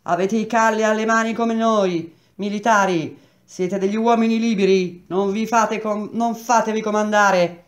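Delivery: arringa gridata, forte e acuta; grido. A narrator's reading. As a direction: grida ai soldati con voce alta e tesa. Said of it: "Friani gridò". (urlato ai militari, tono alto e sostenuto)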